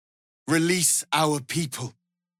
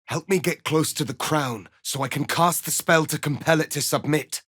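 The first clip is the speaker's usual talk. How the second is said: normal talking voice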